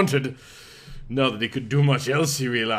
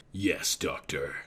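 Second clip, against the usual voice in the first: low, slow and soft, sultry; sexy nurse voice; 10 semitones lower, 9 dB quieter than usual. A narrator's reading. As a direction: purr it like a seductive nurse. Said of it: "so sweet and sexy".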